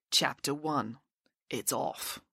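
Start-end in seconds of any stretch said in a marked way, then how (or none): none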